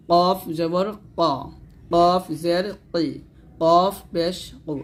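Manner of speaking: "কফ", "কি", "কু" drawn out heavy. Shown as a demonstration. Teacher showing learners the thick letter, heavy "কফ". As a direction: say "কফ জবর ক" slowly with a thick, full sound. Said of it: "মোটা উচ্চারণ করবে".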